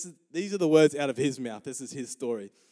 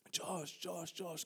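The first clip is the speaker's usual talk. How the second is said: very soft, higher mock call; distant calling; 4 semitones higher, 14 dB quieter, much slower than usual. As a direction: imitate a name called from far away. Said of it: "calling him".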